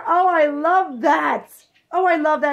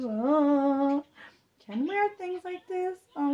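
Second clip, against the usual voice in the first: normal talking voice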